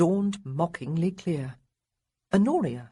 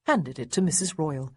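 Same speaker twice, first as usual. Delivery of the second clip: ordinary delivery, nothing marked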